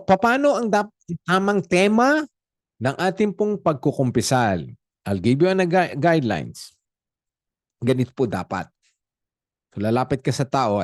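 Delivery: ordinary delivery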